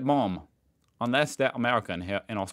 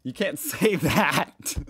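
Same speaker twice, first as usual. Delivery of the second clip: slow, breaking into laughter; can't get the words out for laughing; 5 semitones higher, 4 dB louder, much slower than usual. Real, not acted. Vlogger cracking up at Aussie slang, laughing.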